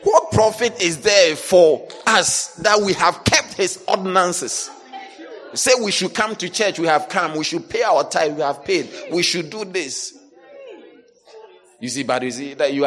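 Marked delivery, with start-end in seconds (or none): 0.0-10.1 high, loud sung-out preaching; preaching at full force. Real, not acted. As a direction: belt it like a revival preacher. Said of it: "Am I preaching?".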